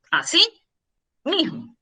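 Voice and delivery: "así", a short pause, then "mismo"; pause between words. Shown as a demonstration. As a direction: split "así" and "mismo" into two separate words. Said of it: "en dos palabras".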